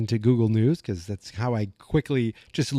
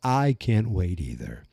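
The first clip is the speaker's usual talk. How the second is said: low, slow, smooth put-on voice; hammed-up soothing low voice; put-on calm voice; 5 semitones lower, slower than usual. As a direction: drop into a deep, unhurried voice. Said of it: "I wish I talked like this".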